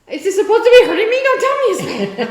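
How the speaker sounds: high, loud, rapid frantic outburst; mock panicked outburst. To the dentist, begging him not to say it hurts: frantic, high, fast shout. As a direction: blurt it out fast in a hammed-up panic.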